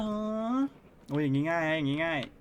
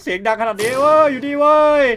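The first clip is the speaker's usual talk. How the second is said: taunting shout; 10 semitones higher, 13 dB louder than usual. Real, not acted. Taunting the monster while hiding.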